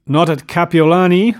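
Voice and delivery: sharp, loud, high challenge; sudden demand. A narrator's reading. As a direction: fire the question at her. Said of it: "demanded Tom suddenly".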